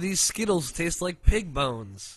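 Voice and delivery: loud, high, drawn-out mock complaint; mocking imitation. Teasing Greg about off-brand candy, mockingly exaggerated. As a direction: imitate a fussy complainer, big and slow.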